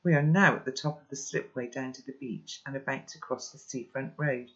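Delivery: usual speaking voice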